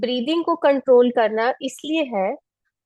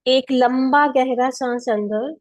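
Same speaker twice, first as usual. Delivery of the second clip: calm, unhurried meditation voice; guided meditation; faster than usual. Acted. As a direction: guide them gently through each breath. Leads seated pranayama. (ध्यान कराने वाली शांत आवाज़)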